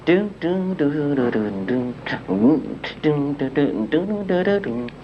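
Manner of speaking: doo doo bass line, mouth instrument; beatboxed bass line. Shown as a demonstration. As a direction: hum the bass part. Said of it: "noises like that".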